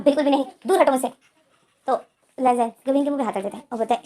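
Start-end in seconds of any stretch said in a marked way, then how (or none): 0.0-1.1 loud, fast outburst pushing him away; angry shout. Acted. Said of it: "चीखो मत". (ज़ोर से चीखता हुआ गुस्सा)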